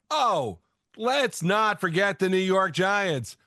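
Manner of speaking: ordinary talk, nothing marked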